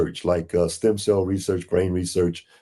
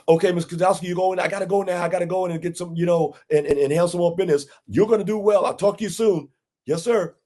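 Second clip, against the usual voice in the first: higher, slick salesman voice on the phone; imitating the broker; 10 semitones higher, faster than usual. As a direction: play the smooth broker closing the call. Broker ending phone call, retold.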